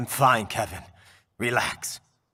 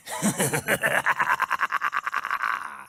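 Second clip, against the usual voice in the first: mocking laugh; 6 semitones higher than usual. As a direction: laugh right in his face. Villain mocks Raiden.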